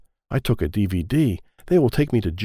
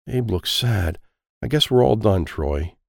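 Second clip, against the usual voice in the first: ordinary delivery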